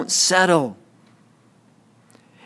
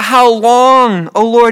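raised-voice plea to God; crying out; 2 semitones higher, 5 dB louder, slower than usual. Acted. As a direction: cry it out to the Lord, louder. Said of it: "We cry out".

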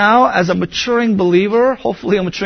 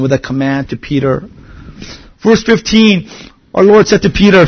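ordinary talk, nothing marked

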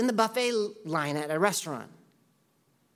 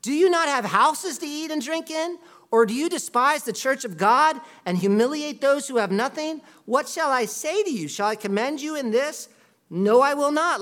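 incredulous outburst, higher and louder; shocked outrage; 5 semitones higher, 5 dB louder than usual. Acted. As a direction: burst out in a high loud What, then keep the rebuke loud. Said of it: "he's shocked".

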